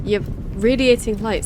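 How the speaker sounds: ordinary delivery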